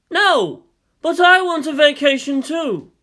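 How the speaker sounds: high, loud whining refusal; loud protest. Acted. Shinji refusing Yang's army, loud whiny protest. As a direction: push back hard, then plead.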